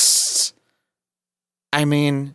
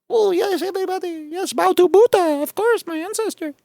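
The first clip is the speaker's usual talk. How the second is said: very high mocking squeaky caricature voice. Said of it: "with tape on the sides of my eyes going".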